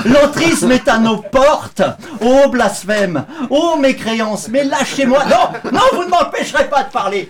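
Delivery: outraged zealot. Acted. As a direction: play a scandalized zealot refusing to be silenced. Parody outraged protester.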